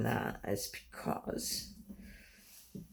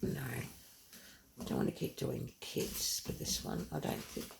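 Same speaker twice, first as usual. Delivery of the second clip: quiet at night; 4 dB quieter than usual. Real, not acted. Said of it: "I'm being quiet because Michael's asleep".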